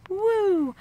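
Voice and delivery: high, drawn-out "woo"; excited whoop. Real, not acted. Vlogger whooping before getting serious, excited whoop.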